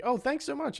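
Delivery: imitating an overjoyed ten-year-old thanking his idol; gushing kid fan. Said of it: "My dream ten-year-old wanna be like".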